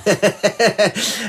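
a short, high, loud chuckle at his own joke; hearty laugh. Acted. Police chief laughs aloud at his own Prince of Wales joke. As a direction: laugh it off, pleased with himself.

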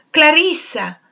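calling out high and loud after her; shouted name. A narrator's reading. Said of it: "egli gridò". (chiamata gridata, acuta e forte)

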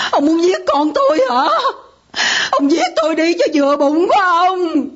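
wife accusing husband of harming their daughter, crying in anger; angry crying accusation. Acted. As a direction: hurl it at him through tears.

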